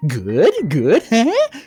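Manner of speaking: high, loud eager question; eager host. A narrator's reading. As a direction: push him for praise.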